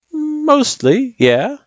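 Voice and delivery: drawn-out, high hedging answer. Acted. Sans grading the kid's test, hedging. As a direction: stretch it out as a reluctant verdict.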